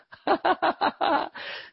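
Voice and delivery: high scornful laugh that falls away; mocking laugh. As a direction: laugh down at the show-off. Laughs at the imagined boaster. (嘲笑地大笑)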